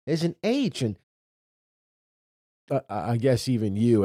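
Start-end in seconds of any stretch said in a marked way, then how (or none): 0.1-0.8 put-on high-pitched little-kid discovery; pitched-up, delighted child imitation; excited child. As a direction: imitate an excited daughter pointing out a letter. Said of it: "They're so excited about it".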